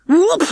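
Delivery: high, loud wobbling whoop; tumbling whoop. A narrator's reading. Rats knocked tumbling by Bunty, whooping. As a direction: cry out while bowled over.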